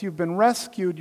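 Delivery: usual speaking voice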